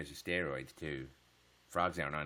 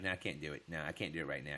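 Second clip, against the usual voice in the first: ordinary talk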